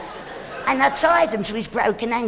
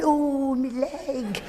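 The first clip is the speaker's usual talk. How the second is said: mock moan; 6 dB quieter, much slower than usual. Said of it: "lying on the bottom of the cage going".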